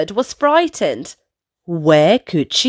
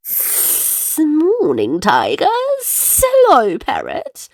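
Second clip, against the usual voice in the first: grinning snake; 3 semitones higher than usual. Acted. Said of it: "Snake says with a grin".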